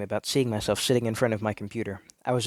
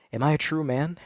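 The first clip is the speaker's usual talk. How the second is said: usual speaking voice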